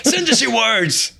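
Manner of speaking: hammed-up yelled appeal, high and drawn out. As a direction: yell it like a telethon plea, slowly. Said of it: "be like [name]".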